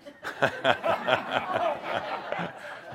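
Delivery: breathy burst of chuckling; real laugh at your own point. Real, not acted. Speaker amused telling audience the problem is bigger, laughing.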